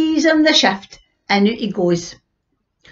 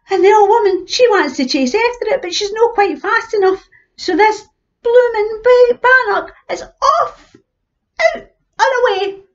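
excited telling; 13 semitones higher, 3 dB louder than usual. A narrator's reading. Old woman chases runaway bannock.

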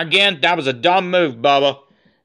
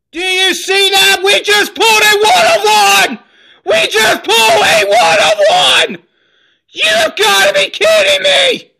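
shrieking, high and loud, voice cracking with joy; can't believe your luck, yelling at the camera; ecstatic screaming; 7 dB louder than usual. Real, not acted. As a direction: scream it like you just hit the jackpot. Pulled a one of one card.

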